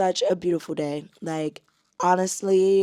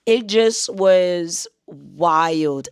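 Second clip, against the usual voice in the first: drawn out, each word weighted; "it just was wild" spoken very slowly and firmly; slow emphatic; 7 dB louder, much slower than usual. Real, not acted. Telling viewers last year was wild, slow emphasis. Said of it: "last year".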